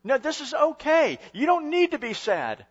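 high, slow mock cheerful comforting. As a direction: brush off the grief brightly. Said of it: "They're gonna say".

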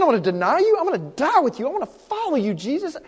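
high-pitched, incredulous protest; Peter, emphatic protest. Retold or imitated. Disciple protesting to Jesus that he would never deny him, emphatic. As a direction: swoop up high in insistent disbelief.

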